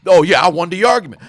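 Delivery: loud, triumphant mock boast; mock gloating. Arguer reacting to the other conceding, loud mock gloating. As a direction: crow over a win, playfully acted. Acts out winner after a concession.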